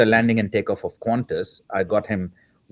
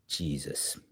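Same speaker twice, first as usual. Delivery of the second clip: barely breathed, much softer; hushed "Jesus" on hearing the star's name; stunned hush; 6 semitones lower, 13 dB quieter than usual. Retold or imitated. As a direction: let it slip out under your breath in shock. And I just kept quiet.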